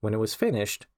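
ordinary delivery, nothing marked

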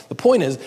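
"point" hit high and loud; emphatic point. Real, not acted. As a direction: stress "point" hard, falling. Preacher repeats emphatic point.

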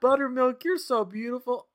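put-on high falsetto kid voice; cartoon kids. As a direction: gush sweetly as a cartoon child. Voicing cartoon kids again.

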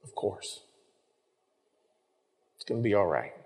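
hushed, soft parent's comfort; tender reassurance. Retold or imitated. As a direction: whisper comfort to a scared child. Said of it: "That's the reassurance".

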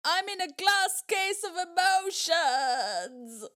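high, loud, slow fake sobbing; mock crying Will Ferrell. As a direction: wail it like a crying man-child. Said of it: "he's just, like, crying".

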